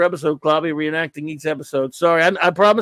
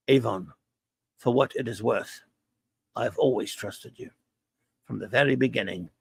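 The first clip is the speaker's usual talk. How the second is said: quiet, lower, sincere confession; a touch of tender Blake to Avon; soft sincere Blake; 5 semitones lower, 7 dB quieter than usual. Retold or imitated. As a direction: say it softly, from the heart. Blake's sincere farewell, reenacted.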